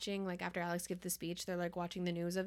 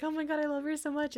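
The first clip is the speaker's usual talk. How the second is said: gushing; 7 semitones higher, 5 dB louder than usual. Retold or imitated. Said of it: "he's just like".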